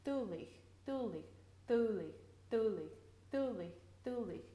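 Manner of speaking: dulich, dulich, again and again, softly; repeated broad d drill. Shown as a demonstration. Gaelic teacher drilling the word for students. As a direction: drill dulich with the tongue on the top teeth. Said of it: "is touching up against the back of the".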